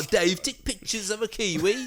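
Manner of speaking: put-on high, laughing Kiwi accent. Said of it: "mock Kiwi accent again".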